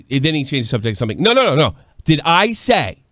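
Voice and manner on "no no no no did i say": louder and higher, cutting him off; slow, pointed challenge; mock heated retort. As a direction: slam the brakes on his dodge. Retold heated bar argument.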